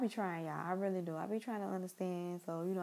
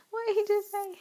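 high, loud incredulous squeal; mock astonishment, pitched way up and loud; shocked parent reaction; 14 semitones higher, 11 dB louder than usual. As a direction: burst out high in disbelief, half joking.

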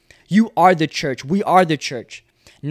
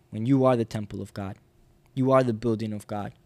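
ordinary delivery

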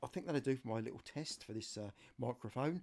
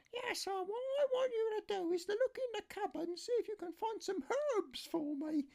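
much higher falsetto voice for his niece; niece's voice. Retold or imitated. Niece phoning her uncle for help, high falsetto. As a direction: play the niece asking a favour, pitched way up. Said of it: "I've got wrong voice".

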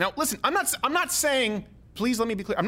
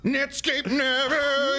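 mock young voice; 9 semitones higher, slower than usual. Mocking young person.

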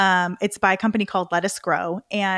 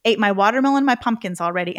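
ordinary talk

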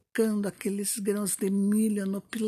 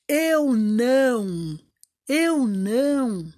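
high, loud, slow and drawn out; hen's payback refusal; 5 semitones higher, 5 dB louder, slower than usual. Acted. The hen turns the three greedy animals away, in a high loud drawn-out voice. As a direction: savour every no.

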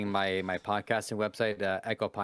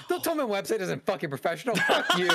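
retold angry shout; 11 semitones higher than usual. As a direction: blow up at the caller and hang up. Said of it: "so I say in response".

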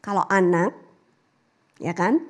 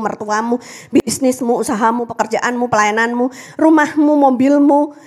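ordinary delivery

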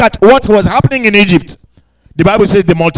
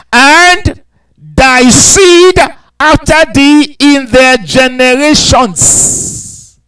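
drawn-out proclaiming; dramatic scripture climax; 4 semitones higher, slower than usual. Acted. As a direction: stretch each word out for weight. Builds to a slow climax.

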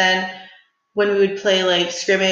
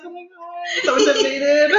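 high, starstruck gush; mock excited; 10 semitones higher than usual. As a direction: gush like an eager fan. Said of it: "I'm just like".

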